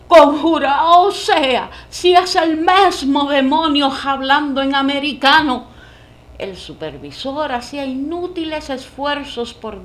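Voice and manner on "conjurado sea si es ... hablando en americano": put-on loud panicked old woman cry; terrified old woman. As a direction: shriek it in holy terror. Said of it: "Una vieja se persignó y dijo". (vieja aterrada gritando)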